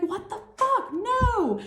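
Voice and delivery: high-pitched, acted alarm; shocked disbelief. Plotters discovering you know the truth, shocked disbelief. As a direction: shoot the pitch up in mock horror.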